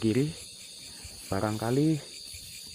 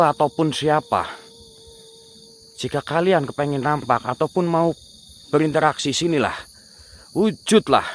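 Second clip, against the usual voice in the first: loud commanding call, higher; summoning a spirit; 4 semitones higher, 6 dB louder than usual. Real, not acted. Ghost hunter commands the crying spirit to appear, loud. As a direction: order the spirit to show itself.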